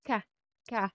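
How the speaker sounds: "ka" with a hard k sound. Shown as a demonstration. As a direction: sound out "ka" as a short hard-k syllable. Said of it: "hard K".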